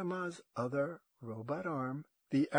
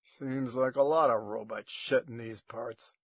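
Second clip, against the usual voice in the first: menacing growled remark; 7 dB louder than usual. A narrator's reading. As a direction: growl it out, louder than usual. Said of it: "he growled".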